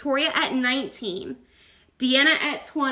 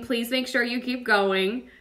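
ordinary talk

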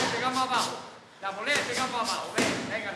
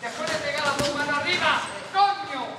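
higher, louder shouted correction; exasperated shout; 4 semitones higher, 4 dB louder than usual. Real, not acted. Coach correcting boxer's dropped hands, exasperated shout. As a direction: build to a sharp shouted curse.

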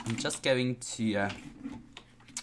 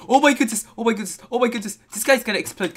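high, loud and fast panic over an exploding mob; panicked; 11 semitones higher, 10 dB louder, much faster than usual. Real, not acted. Gamer panicking as a creeper is about to explode, high frantic yelps. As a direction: yelp it out rapid-fire as the creeper swells.

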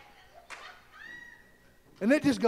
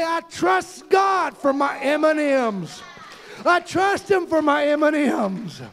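loud, rising sermon cadence; preaching at full force; 7 semitones higher, 4 dB louder than usual. Acted. Preacher rousing the congregation, full-force preaching. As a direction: preach it out, voicing the complainers. Preaching again after the aside.

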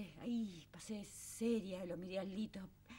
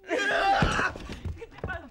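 slow, much higher and louder cry of alarm; alarmed shout; 9 semitones higher, 12 dB louder, much slower than usual. Acted. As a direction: scream it in fright at the blood. Sees Juanjo bleeding. (grito de susto al ver la sangre)

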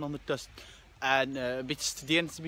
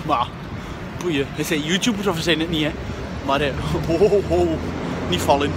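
loud over the wind; 10 dB louder than usual. Real, not acted. Biking, loud, almost falling.

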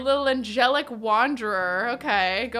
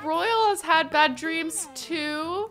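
put-on childlike voice; 7 semitones higher, slower than usual. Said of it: "She'll be like".

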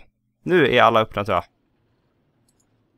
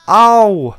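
loud pained ow; 10 semitones higher, 12 dB louder than usual. Real, not acted. Guests drowning in the pool.

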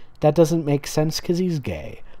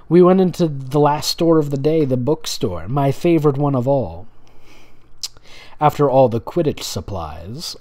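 usual speaking voice

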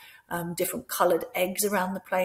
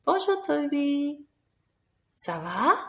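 put-on higher, bright bonjour to each child; cheerful greeting; 7 semitones higher, slower than usual. Shown as a demonstration. Teacher greeting children's dolls in French. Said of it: "say hello to them in French".

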